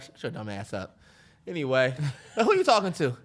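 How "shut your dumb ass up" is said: low and much softer snap; muttered aside at the cat; quiet curse. Pretend. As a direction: hiss it at the cat under your breath.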